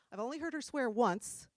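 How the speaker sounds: ordinary delivery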